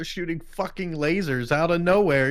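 usual speaking voice

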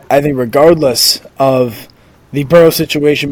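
usual speaking voice

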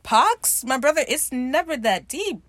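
high, loud scoffing disbelief; mock incredulity. Speaker answering Izzy's curse, mock incredulous. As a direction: query it loudly, then talk him down.

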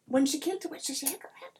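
put-on high accented voice, softening to an aside; puzzled little-folk voice; puzzled leprechaun. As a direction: confer with the other leprechauns, baffled. Leprechauns confer about stranger.

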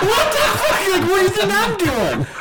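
high, loud, laughing outburst; arguing with gym buddy, high laughing yell; mock outraged yell. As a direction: blow up in disbelief, laughing. Heated argument, his own retort.

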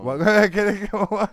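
giggling through the word; breaking up laughing mid-word. Real, not acted. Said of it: "this is a mess".

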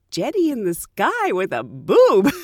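slow, loud, disbelieving repeat; incredulous echo. Retold or imitated. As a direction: repeat it slowly in mock disbelief. Incredulous reaction to the misnaming.

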